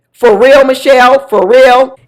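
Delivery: loud, high, appalled; exasperated for real; outraged disbelief. Real, not acted. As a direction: call her out in disbelief. Outraged reaction to Michelle.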